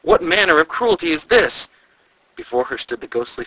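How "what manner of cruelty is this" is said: shocked. A narrator's reading. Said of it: "she said in astonishment".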